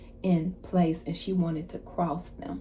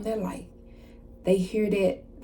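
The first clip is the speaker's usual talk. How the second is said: normal talking voice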